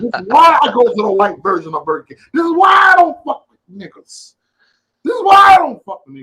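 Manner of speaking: loud, high furious rant; ranting shout. Retold or imitated. Customer ranting at phone-distracted cashier, shouting. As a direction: build it into a yelling tirade. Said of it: "I said it just like that".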